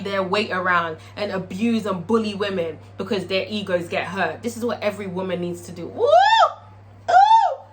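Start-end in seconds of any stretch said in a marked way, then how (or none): 5.9-7.6 high, loud sustained "ooh"; singing for joy. Real, not acted. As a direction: whoop and hold a high sung note. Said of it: "Shit made me sing".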